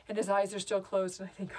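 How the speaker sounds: ordinary delivery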